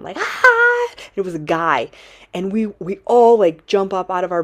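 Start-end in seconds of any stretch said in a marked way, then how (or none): 0.2-0.9 high, loud imitated scream; a short shrill scream, retold; mock scream. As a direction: let out a piercing yelp. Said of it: "blood curdling scream".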